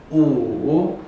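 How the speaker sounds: 乌 dipping and rising; third tone. Shown as a demonstration. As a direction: dip 乌 then lift. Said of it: "第三个".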